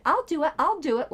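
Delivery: high, eager mock volunteering; mock eager teacher's pet. Mocking a rival as a teacher's pet, eager whine. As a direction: ham up the eager kid begging to help. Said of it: "like the teacher's pest and said".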